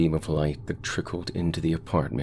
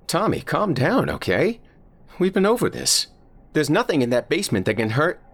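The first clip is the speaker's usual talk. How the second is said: lightly voiced dad, much higher, louder and rushed; urgent dad; 14 semitones higher, 5 dB louder than usual. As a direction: push hard to calm him down, fast and high. Dad urging terrified son to calm.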